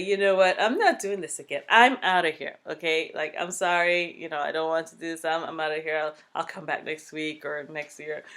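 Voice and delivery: loud fed-up venting; loud, half-joking I'm done; mock exasperated outburst. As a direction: throw up your hands and quit. Retelling giving up in labor.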